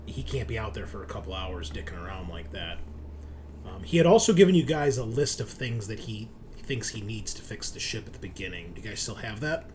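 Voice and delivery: normal talking voice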